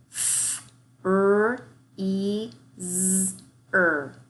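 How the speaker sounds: "Freezer" split into sounds, with the blend "F" and "R" kept apart; segmented sounds. Shown as a demonstration. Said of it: "hears two sounds in fur".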